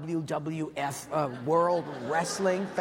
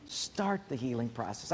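much softer, lower "Start the healing process"; soft echo; 7 semitones lower, 6 dB quieter than usual. Shown as a demonstration. Preacher echoing his point to the church, softly. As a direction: let "Start the healing process" fall to a hush. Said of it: "repeated phrase".

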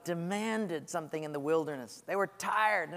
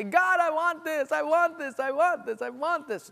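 higher, whining, repeated demand; whiny demand; 9 semitones higher, 3 dB louder than usual. Retold or imitated. Believer demanding things from God, whiny and higher. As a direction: whine at God like a spoiled child.

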